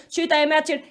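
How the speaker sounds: ordinary delivery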